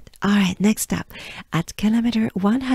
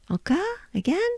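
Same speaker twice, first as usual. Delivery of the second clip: usual speaking voice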